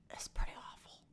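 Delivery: embarrassed whispered admission; whispered aside. Real, not acted. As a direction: whisper it as a confession. Admitting her singing is bad.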